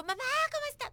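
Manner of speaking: imitating her own over-eager hello to mom; effusive loud greeting. Calling her mom on Skype from abroad, imitating her own loud greeting. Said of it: "que era efusivo".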